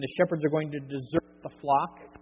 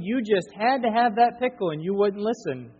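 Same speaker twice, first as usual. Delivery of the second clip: scolding mother; 5 semitones higher, 5 dB louder than usual. Retold or imitated. Mother scolding son over pickle.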